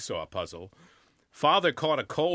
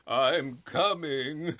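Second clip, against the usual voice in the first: mournful; much slower than usual. Acted. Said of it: "the mournful, resigned note".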